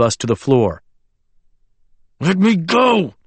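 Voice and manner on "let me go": muffled, face pressed into an arm. Acted. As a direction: speak through a muffled mouth. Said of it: "voice muffled where his face was caught".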